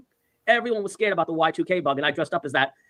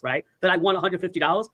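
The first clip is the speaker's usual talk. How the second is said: normal talking voice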